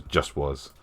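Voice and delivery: ordinary talk, nothing marked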